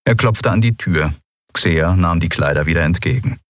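ordinary delivery

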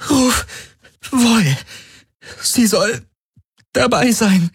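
high, strained, broken words; strained in labor pain. Acted. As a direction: push the words out between contractions. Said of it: "presste sie zwischen den Krämpfen hervor".

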